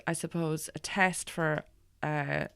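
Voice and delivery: normal talking voice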